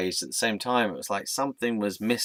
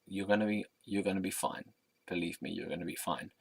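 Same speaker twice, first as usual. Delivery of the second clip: softer, steady, quietly certain; soft certain reassurance; 9 dB quieter than usual. Retold or imitated. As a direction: reassure him gently but with total certainty. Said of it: "had a sense of certainty".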